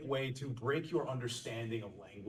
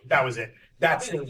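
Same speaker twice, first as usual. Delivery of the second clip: much louder, delighted approval; mock thrilled client; 2 semitones higher, 10 dB louder than usual. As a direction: cheer as the client who finally hears it. Client thrilled by old takes.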